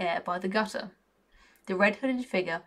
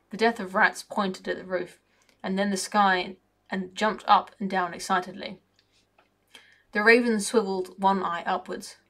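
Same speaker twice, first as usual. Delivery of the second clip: ordinary delivery, nothing marked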